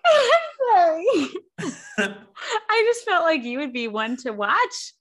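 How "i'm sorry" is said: laughing, high and slow; giggling sorry; laughing apology. Real, not acted. Caught out, laughing at co-host.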